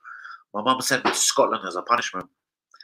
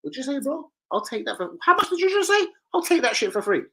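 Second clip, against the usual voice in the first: mock street tough; 14 semitones higher than usual. Acting out the haggling boy.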